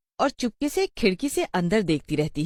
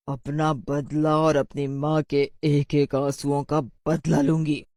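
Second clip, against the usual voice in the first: vengeful witch; 2 semitones lower than usual. Acted. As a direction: draw out the threat like a wronged witch. Witch character's revenge speech.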